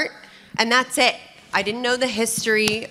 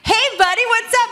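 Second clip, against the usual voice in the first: much higher and louder, bright mock hello; cheery greeting; 14 semitones higher, 5 dB louder, faster than usual. Greeting Elon as an old friend now, high cheery hello. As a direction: toss it off like greeting an old pal. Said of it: "now I'm like".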